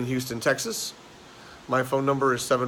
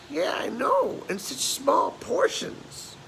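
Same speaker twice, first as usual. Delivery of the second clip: put-on very high, drawn-out old lady voice; slow, piping old lady grumble; second elderly woman; 13 semitones higher, much slower than usual. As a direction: agree in a high-pitched old woman's complaint. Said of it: "The other one says".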